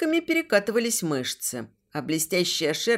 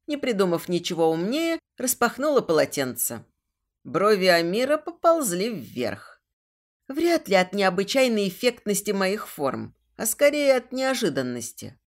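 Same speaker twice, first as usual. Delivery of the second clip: usual speaking voice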